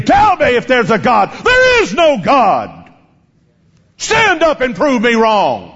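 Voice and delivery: high, drawn-out screaming challenge; acted scream. Atheist defying five thousand believers, full-force acted scream. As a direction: scream it at the whole crowd, stretching the words. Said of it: "Then he began to scream".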